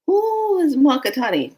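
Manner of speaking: high, loud baffled question; acted bewilderment. Newcomer hears a strange name, acts loudly bewildered. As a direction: blurt it in comic alarm. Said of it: "imagine somebody saying that to you".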